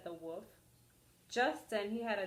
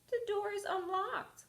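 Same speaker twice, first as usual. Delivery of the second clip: higher sweet old lady put on; fake granny voice; 11 semitones higher than usual. Wolf as Granny invites the girl in, sweetly. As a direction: keep up the granny disguise.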